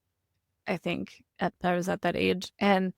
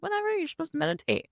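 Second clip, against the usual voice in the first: high, quick dismissive instructor voice; lightly mocking a shrugging meditation teacher; 9 semitones higher, faster than usual. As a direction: toss it off high and fast like a breezy teacher. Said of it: "They're like".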